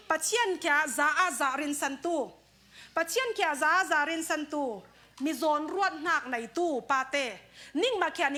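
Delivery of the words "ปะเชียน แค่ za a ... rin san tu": slow emphatic repeat. Shown as a demonstration. Repeating a key phrase slowly.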